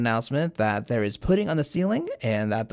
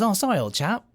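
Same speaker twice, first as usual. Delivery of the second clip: put-on a light, higher British toff accent; mock posh British accent; pompous British accent; 8 semitones higher than usual. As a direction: play a rich British snob, lightly. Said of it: "I don't know why he has a British accent".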